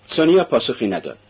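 plain reading voice

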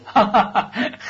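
high, acted burst of laughter; loud guffaw. A narrator's reading. Raskolnikov bursting out at the clerk's boast, guffawing. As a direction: break into a guffaw. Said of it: "با قهقهه خندید و گفت".

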